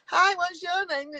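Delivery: high, slow, sugary fake-friendly greeting; mock fake friendliness. Mocking fake Mancunian friendliness, high and slow. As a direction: send up a phoney stranger's over-sweet hello. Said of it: "Don't go".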